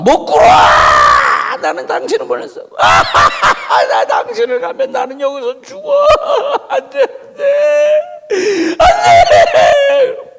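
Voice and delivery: high, loud refusal breaking into a laughing cry; cling on and wail, drawn out; desperate shouting. Acted. Jacob refusing the angel as they wrestle, shouting and half-crying.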